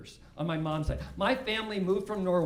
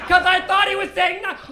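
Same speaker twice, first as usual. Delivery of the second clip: normal talking voice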